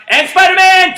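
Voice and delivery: high, loud shout; called-out command. Real, not acted. Coach calling the Hulk command: big excited shout. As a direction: bark it out to the class.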